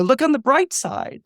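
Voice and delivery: high, sing-song optimism; chirpy Joy. Retold or imitated. Joy brushing off Bing Bong's loss, chirpy and high. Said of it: "she's coming up with".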